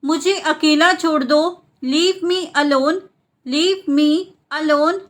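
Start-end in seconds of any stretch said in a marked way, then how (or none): 3.5-5.0 split repeat. Shown as a demonstration. Teacher repeats the translation for learners, split in two. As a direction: pause between "Leave me" and "alone".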